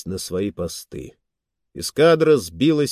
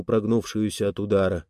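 plain reading voice

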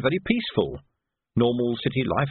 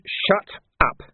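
irritated order; 7 semitones higher than usual. Acted. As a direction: cut the boy off. Major cuts Nobby off.

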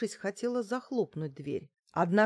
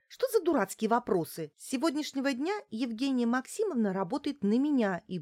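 higher, forceful announcement; irritated outburst; 7 semitones higher, 4 dB louder than usual. Acted. Client putting the housekeeper in her place, irritated. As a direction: lay down the law in a raised pitch.

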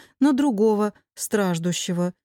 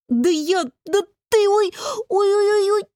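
high sputter breaking into moans; cut short by pain; 10 semitones higher than usual. A narrator's reading. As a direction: start arguing, then wail from a cramp. Angry retort cut off by painful spasm. (вскрикивает и стонет от боли)

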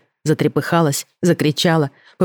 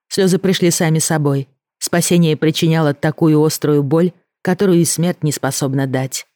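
ordinary delivery, nothing marked

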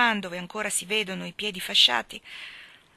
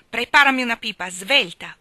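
loud commanding order; sharp order; 3 semitones higher, 10 dB louder than usual. A narrator's reading. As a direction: snap the command at her. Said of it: "ordinò".

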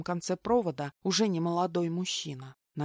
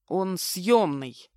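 slow, grudging, sullen answer; 4 dB louder, much slower than usual. A narrator's reading. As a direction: let the words out slowly and sullenly. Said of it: "хмуро процедил Виталик". (хмуро, цедя слова)

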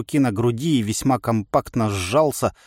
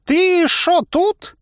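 put-on very high, drawn-out astonished old man; 5 dB louder, much slower than usual. A narrator's reading. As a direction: stretch it out in high-pitched disbelief. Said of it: "удивился столб". (изумлённо, высоко и протяжно)

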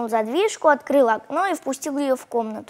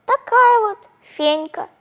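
put-on high, slow childish insistence; tiny girl, matter-of-fact; little girl voice; 8 semitones higher, 4 dB louder, slower than usual. A narrator's reading. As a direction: state it stubbornly like a small child.

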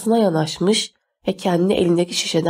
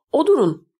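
high-pitched order; commanding; 6 semitones higher than usual. A narrator's reading. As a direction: command them like a bossy girl. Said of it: "diye buyurdu".

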